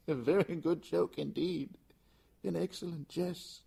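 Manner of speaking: high, loud, laugh-broken pleading jest; desperate forced joking. Acted. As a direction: joke high through panic, laughing. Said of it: "a sad voice ... The voice said".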